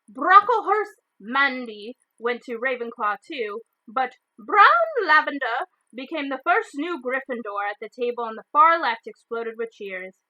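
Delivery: ordinary delivery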